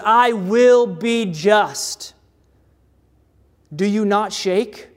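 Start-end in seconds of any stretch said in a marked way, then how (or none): 0.0-2.1 slow, weighty proclamation with each word spaced out; deliberate, grave voice of God; God's solemn declaration. Acted. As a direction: give every word its own heavy beat. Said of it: "He looks at you and says".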